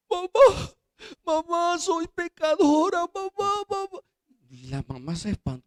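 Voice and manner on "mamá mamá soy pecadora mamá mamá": put-on high, loud sobbing child's plea; a nine-year-old crying out to her mother; crying little girl. Girl sobbing to her mother over her sins, high and pleading. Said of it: "y la niña comenzó a llorar".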